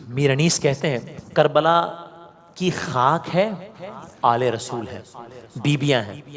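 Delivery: usual speaking voice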